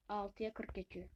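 quiet, stunned murmur at the clock; quiet disbelief. Real, not acted. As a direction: read the time low, half to yourself. Realizes how late it got.

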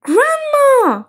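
high, drawn-out cry; surprised cry. A narrator's reading. Toads finding Grandma in the teapot, crying out. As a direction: cry out in high-pitched astonishment. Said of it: "cried the toads".

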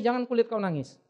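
ordinary talk

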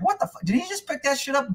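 stunned under the breath at the cook; high hushed shock; shocked disbelief. Retold or imitated. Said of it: "I was like".

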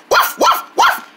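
high, short puppy barks; two quick high woofs; dog barking. Acted. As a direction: yap like a little dog. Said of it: "Look, there's a dog!".

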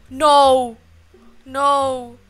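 loud, wailed refusal; despairing protest. Real, not acted. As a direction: cry out in dismay. Despairs at not jumping.

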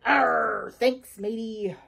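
slow, growled pirate drawl; big pirate voice. Acted. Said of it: "The big voice said".

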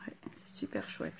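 low, soft whispered aside. Real, not acted. Crafter admires the piece in a whisper. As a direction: whisper it to yourself.

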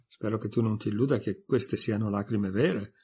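plain reading voice

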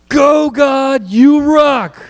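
high, hollered group chant; long, rousing yell up high; all-out cheer. Real, not acted. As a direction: shout it like a fan in the stands. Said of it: "as loud as we can".

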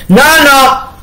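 high, loud refusal; cruel refusal. A narrator's reading. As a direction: cut her plea off. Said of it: "rispose il crudele marito".

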